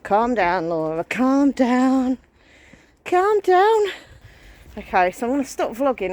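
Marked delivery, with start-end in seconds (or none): 0.0-3.8 self-soothing, rising to a high anxious plea; anxious self-reassurance; calming herself. Real, not acted. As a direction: talk herself down from panic, slow then pitched up. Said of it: "calming herself during panic".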